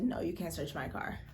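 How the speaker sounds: normal talking voice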